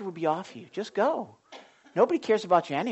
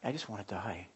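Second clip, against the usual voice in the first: much lower and softer, a dark murmur; low hush; 10 semitones lower, 17 dB quieter than usual. Retold or imitated. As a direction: let it sink low and soft. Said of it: "What, what is that?".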